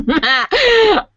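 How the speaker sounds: loud, high burst of laughter; laughing. Real, not acted. Host cracking up after reading a question, laughing.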